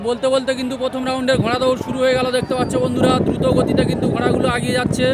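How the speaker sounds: high, loud excited race call; race caller. Real, not acted. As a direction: call the race as the horses break. Horse race starts, live commentary.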